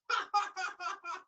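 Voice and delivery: high cackling bird laugh; bird's laugh. Acted. Blackbird laughs at the young man, high cackle. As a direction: let out a high chirpy cackle.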